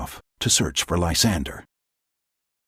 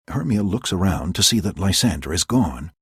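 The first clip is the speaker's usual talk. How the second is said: usual speaking voice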